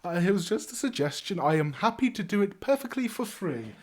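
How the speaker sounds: cheerful bard character. Acted. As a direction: play an obliging bard, pitch lifted. Bard character speaking in game.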